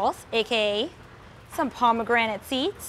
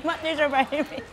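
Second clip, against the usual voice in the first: mouth burning from spicy food; 3 semitones higher than usual. Real, not acted. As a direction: repeat it while the mouth burns. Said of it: "it's so hot".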